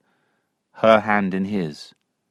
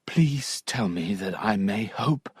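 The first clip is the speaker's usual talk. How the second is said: whispered plea; 7 dB quieter than usual. Acted. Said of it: "cried Tom".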